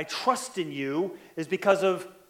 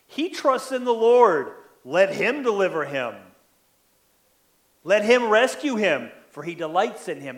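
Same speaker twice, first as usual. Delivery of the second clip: sarcastic mocking; 2 semitones higher, 6 dB louder than usual. Enemies taunting David about his trust in the Lord, loud mocking jeer. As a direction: sneer it like scoffers wagging heads. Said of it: "Those are the mocking, sarcastic words".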